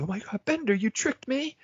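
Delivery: put-on high, far-off girlish voice of a tricked woman; Angeline's shocked line. Recapping a cartoon, voicing Angeline's shocked discovery. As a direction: shriek it up high like a duped girlfriend. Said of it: "So she said".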